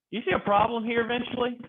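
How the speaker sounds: usual speaking voice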